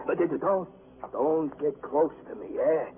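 high, halting fearful plea; fearful warning. Acted. Prisoner keeping the detective away, fearful warning. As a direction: back away in panic, voice climbing.

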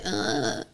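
whispered breathy moan; barely voiced sleep groan; faint moan.

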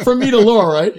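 ordinary talk

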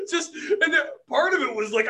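loud laughter on the words; still laughing. Real, not acted. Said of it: "still laughing".

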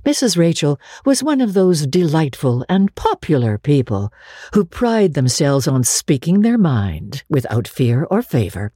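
plain reading voice

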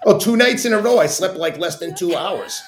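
laughing as his host confirms he slept badly. Real, not acted. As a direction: laugh out the confession of sleeping badly. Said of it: "your sleep sucked".

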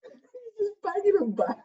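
high, slow, near tears from the heat; strained teary voice. Real, not acted. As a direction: choke it out, eyes streaming. Crying from chili heat.

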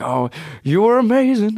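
light sing-song take on the singer's voice; a hint of crooner in the compliment. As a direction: lilt it like he's half singing. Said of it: "I like how he says that like he sings".

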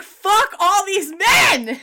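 high, loud, drawn-out outburst; exasperated shout. Acted. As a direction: shout it slowly in comic fury. Exasperated outburst at the film's men.

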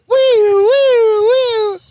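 high loud whistle; whistling sound effect. Retold or imitated. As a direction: squeal like a kettle. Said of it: "my impression of a teapot".

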